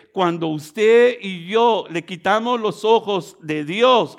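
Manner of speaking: impassioned sermon, punching key words loudly; full-force preaching. Real, not acted. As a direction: drive each phrase home to the congregation.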